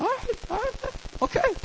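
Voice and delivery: tiny, very high, soft voice; mock-timid falsetto; intimidated. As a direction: back down in a squeak. Backs down from big bouncer.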